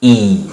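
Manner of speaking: sustained throaty ई; throat ee. Shown as a demonstration. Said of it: "यहां से आवाज आई इस बार".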